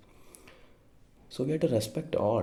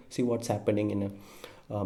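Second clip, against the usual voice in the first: ordinary talk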